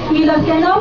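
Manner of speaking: usual speaking voice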